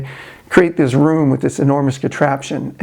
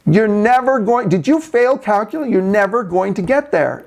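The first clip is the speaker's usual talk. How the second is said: taunting; 7 semitones higher than usual. Retold or imitated. Mathematician taunts the working engineer, high mocking singsong. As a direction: needle him from up high. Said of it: "the mathematician is taunting him now".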